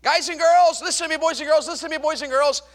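high, loud call to a rowdy crowd; loud, insistent youth-preacher call; calling children to attention. Retold or imitated. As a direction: shout to get the kids listening.

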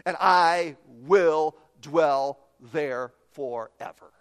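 very slow, firm "I will dwell there forever"; certain David. Retold or imitated. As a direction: draw it out with assurance. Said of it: "No uncertainty in the tone of David".

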